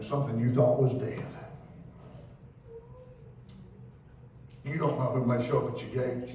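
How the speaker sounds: hushed sermon build; soft preaching. Acted. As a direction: draw them in quietly. Quiet, slow sermon passage.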